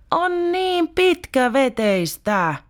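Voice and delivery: slow, high, whiny complaint; whining. Retold or imitated. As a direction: drag it out, bored to death. Bored child whines to mum. (venytetty valittava ääni)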